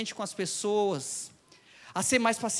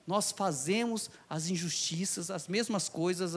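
ordinary talk

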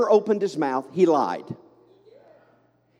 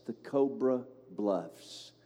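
slow, hushed Southern accent; 7 semitones lower, 10 dB quieter, slower than usual. Real, not acted. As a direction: draw it out softly in a Southern accent. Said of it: "I say that with a southern accent".

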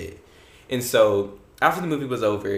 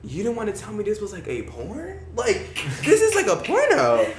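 high-pitched scandalized question; acted shock; 9 semitones higher than usual. Teen to old seatmate about the movie, scandalized disbelief.

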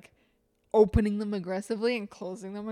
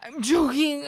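loud high mock choking cry; overacted death scene; 7 semitones higher, 7 dB louder than usual. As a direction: ham up a dying little brother. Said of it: "he was dramatic".

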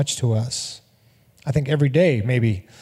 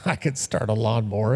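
inner thought, muttered; 7 semitones lower, 6 dB quieter than usual. Retold or imitated. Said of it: "He's thinking".

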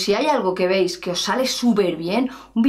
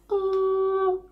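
a much higher, slow, soft bu; 9 semitones higher, 3 dB quieter than usual. Shown as a demonstration. Singing teacher showing a higher comfortable tone. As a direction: demo a comfortable high tone. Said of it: "para otro puede ser".

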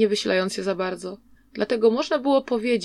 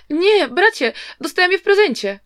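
higher, louder desperate begging; pleading; 9 semitones higher, 5 dB louder than usual. A narrator's reading. Boy begs his brother not to burn the fans, higher pleading cry. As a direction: plead to keep the fans, voice lifted. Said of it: "błagając".

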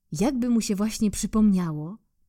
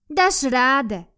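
encouraging squirrel; 6 semitones higher, 5 dB louder, slower than usual. Acted.

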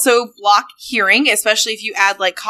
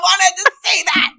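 high squealing laughter through words; laughing; 14 semitones higher than usual. Real, not acted. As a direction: protest while cracking up. Laughing protest after stolen joke.

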